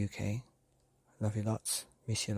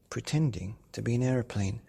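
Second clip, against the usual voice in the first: plain reading voice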